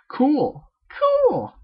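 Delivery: high, loud strained squeak; nervous cool. Acted. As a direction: force out the cool in a panicky squeal. Rainbow nervously agreeing.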